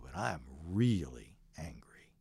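whispering a confession to his crying wife, angry underneath. Retold or imitated. Husband to his crying wife at the bedside, whispered angry confession. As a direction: keep it hushed and tight, barely voiced.

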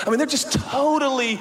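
normal talking voice

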